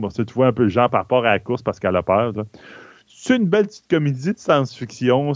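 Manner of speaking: ordinary talk, nothing marked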